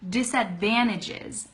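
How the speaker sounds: "disadvantages" run together with the T softened away; "disadvantages" as quick connected "disadvannages". Shown as a demonstration. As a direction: say "disadvantages" smoothly, N in place of NT. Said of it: "say it a lot faster".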